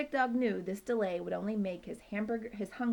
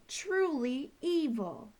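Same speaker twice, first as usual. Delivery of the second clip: grim insistence; 7 semitones higher, slower than usual. Acted. Poo Poo insists.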